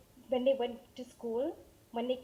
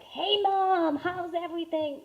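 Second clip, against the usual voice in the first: put-on higher, louder, breezy kid greeting; son's cheery greeting; 5 semitones higher, 6 dB louder, slower than usual. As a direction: toss it off like a busy boy. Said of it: "and he's like".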